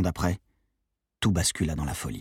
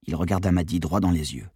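plain reading voice

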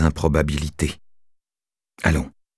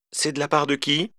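put-on old lady voice; 9 semitones higher, 5 dB quieter than usual. Old woman on phone.

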